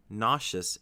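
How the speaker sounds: "nauseous" as NAH-shus, with sh; common pronunciation. Shown as a demonstration. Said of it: "the first one".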